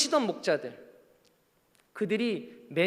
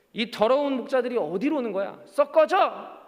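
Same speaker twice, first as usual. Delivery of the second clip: acted angry rebuff, rising to a loud "get out"; hammed-up gatekeeper's dismissal; scornful turning away; 4 semitones higher, 4 dB louder than usual. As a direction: drive them off from the doorway, higher and louder at the end. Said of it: "문전박대 당했을 것입니다".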